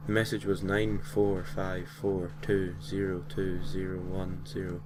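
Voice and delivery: plain reading voice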